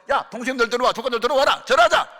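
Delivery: rising to a loud high call. Retold or imitated. Father calling the relatives in to bow, rising shout.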